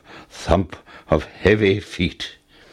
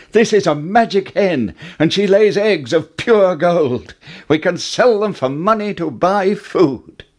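put-on high, eager boy's voice; excited boy; 9 semitones higher than usual. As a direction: bubble over with joy. Jack excited about magic hen.

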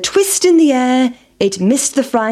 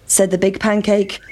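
plain reading voice

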